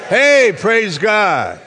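slow, high, loud praise shout. Shown as a demonstration. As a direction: holler it out for the congregation to copy. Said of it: "How about shouting like I can?".

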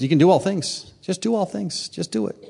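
Paul confidently telling believers just do it. Retold or imitated. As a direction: toss it off like it's obvious. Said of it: "he just confidently says".

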